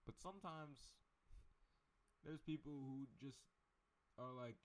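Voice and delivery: ordinary talk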